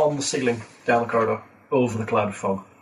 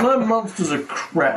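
slow, high-pitched comic moan; mock lament; 7 semitones higher, slower than usual. Game master moaning about his own monsters, mock lament. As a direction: stretch the complaint out high and playful.

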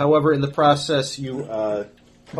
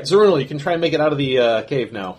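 usual speaking voice

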